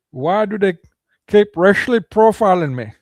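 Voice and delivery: self-admittedly bad hillbilly accent. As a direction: play a complaining hillbilly, hammed up. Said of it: "I can't do a hillbilly accent too well".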